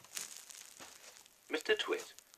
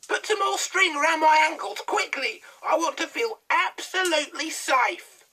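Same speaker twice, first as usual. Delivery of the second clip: much higher, louder witch voice; bossy old hag; 14 dB louder than usual. A narrator's reading. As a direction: order him about like a nagging hag. Mrs Twit demands.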